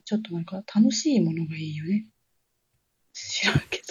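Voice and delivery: hushed embarrassed aside; whispering. Real, not acted. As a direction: drop to a whisper.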